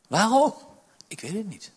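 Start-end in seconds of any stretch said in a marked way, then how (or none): 0.1-0.5 crying out. Real, not acted. Preacher cries out why.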